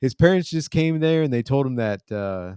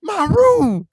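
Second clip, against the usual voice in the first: very high, loud cracking-puberty squeal; mock a teenage boy squeaking in shock; Theo's squeaky voice; 7 dB louder than usual. Narrator imitating Theo finding his room bare, squeaky. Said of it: "he has that squeaky voice".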